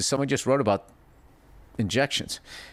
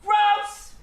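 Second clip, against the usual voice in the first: "Gross!" yelped much higher; mock disgust. Shown as a demonstration. Doing the Bill Burr read of the letter, high squeal. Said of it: "the Bill Burr read".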